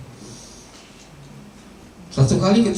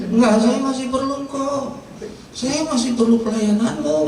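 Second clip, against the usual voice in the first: higher, pleading insistence; insistent husband; 9 semitones higher than usual. Acted. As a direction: refuse to let her go, pushing upward. Smith insisting to his wife.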